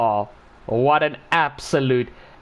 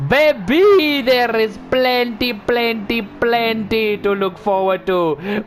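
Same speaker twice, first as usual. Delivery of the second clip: excited gushing; 6 semitones higher, slower than usual. Real, not acted. Driver gushing to his car about its potential, excited. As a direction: croon it with delight.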